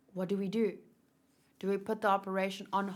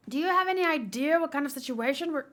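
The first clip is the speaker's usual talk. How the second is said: louder and higher, furious; angry shout; 7 semitones higher, 6 dB louder than usual. Acted. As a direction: yell the question.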